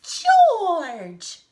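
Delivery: loud, drawn-out screech; high, piercing call. Acted. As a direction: screech the name like a furious mother. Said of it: "a high voice screeched".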